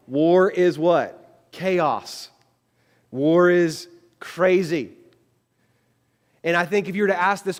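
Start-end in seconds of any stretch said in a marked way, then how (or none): 0.1-4.9 slow, louder emphatic preaching; preaching emphasis. Acted. Preacher drives point home to congregation, slow and loud. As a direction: lean hard on each word.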